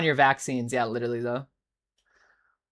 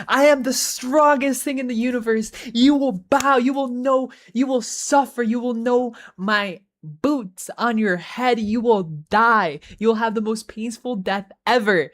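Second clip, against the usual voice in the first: mock screaming shard; 6 semitones higher than usual. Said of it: "the Catan shard is screaming at him".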